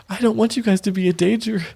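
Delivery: high worried plea; worried mother. Acted. Shinowis pleading worriedly with her grown children, high voice. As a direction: fret over the kids. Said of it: "She's like".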